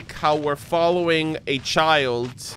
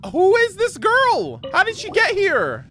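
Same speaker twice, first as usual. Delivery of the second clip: shocked disbelief; 12 semitones higher than usual. Real, not acted. Girl appears out of nowhere.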